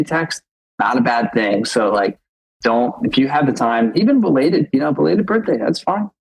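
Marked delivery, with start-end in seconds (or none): none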